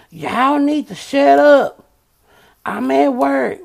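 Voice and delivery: loud high angry yell; yelling at kids. Retold or imitated. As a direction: snap at noisy kids, loud. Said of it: "I have to hear him push mute".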